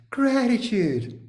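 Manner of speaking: high, slow mock "Gratitude"; sing-song parody of the group's answer; mock chorus. Mocking the group's pious answer, high and slow. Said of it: "And everybody went".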